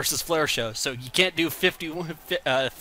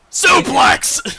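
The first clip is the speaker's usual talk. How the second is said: loud, high burst of a call; hyped commentator calling the suplex; excited yell; 13 semitones higher, 9 dB louder than usual. Real, not acted. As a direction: shout the move as it lands.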